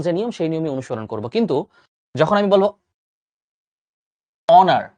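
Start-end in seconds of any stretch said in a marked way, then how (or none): 4.5-4.9 অনার said "onar", no h sound; silent h. Shown as a demonstration. Teacher demonstrates to students that honour begins without an h sound. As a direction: start অনার straight on the vowel. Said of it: "আমি কিন্তু ভাইয়া অনার বলছি".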